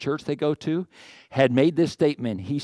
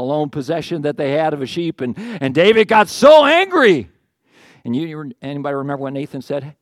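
ordinary talk

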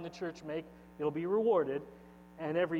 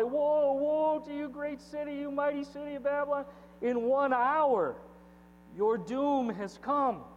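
mourning cry; 7 semitones higher than usual. Acted. Reading the kings' lament.

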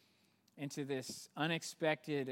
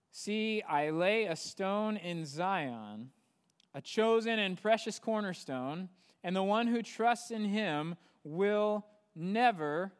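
reading Isaiah out, lifted and louder; fervent scripture reading; 5 semitones higher, 5 dB louder than usual. Acted. Preacher reading the prophecy to his congregation, fervent and raised. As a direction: proclaim the promise to the room.

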